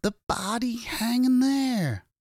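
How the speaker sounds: high, stretched-out shout of horror; panicked high-pitched yell; frantic shout. A narrator's reading. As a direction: cry out slowly to make them look. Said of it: "Ed shouted".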